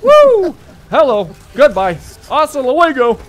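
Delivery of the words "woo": high, loud "woo"; excited whoop. Real, not acted. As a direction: let out a sharp high whoop. Cheering mid-firefight.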